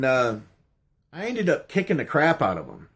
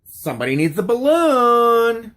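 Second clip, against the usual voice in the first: high, louder goofy clown squeal; 9 semitones higher, 5 dB louder than usual. Retold or imitated. Street clown on a milk crate, high silly voice. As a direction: sing it out like a street clown offering a balloon. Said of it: "looks at me and goes".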